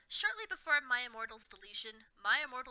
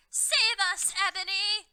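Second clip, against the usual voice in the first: very high, loud plea; cried out; 9 semitones higher, 9 dB louder than usual. A narrator's reading. Dumbledore begging Ebony, high loud cry. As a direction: cry for rescue. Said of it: "Dumbledore cried".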